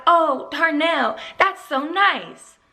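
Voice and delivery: drawn-out, sarcastic gushing; mock delight. [name] mimicking the thanks Darnell expected, mock-delighted. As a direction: stretch it slowly in feigned gratitude. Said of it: "I'm supposed to be like".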